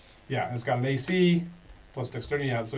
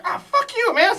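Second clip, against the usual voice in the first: goblin curse; 6 dB louder than usual. Acted. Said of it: "It's like".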